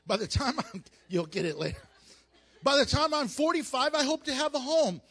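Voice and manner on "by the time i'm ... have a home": eager planner voice. Acted. Mock goal-setter planning life.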